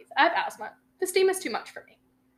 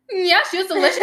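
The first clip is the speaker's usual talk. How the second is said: high, loud, teasing put-on voice; loud high mock answer to a jealous girlfriend; cheeky sarcastic reply; 7 semitones higher, 9 dB louder than usual. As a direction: needle her with a mocking high yes.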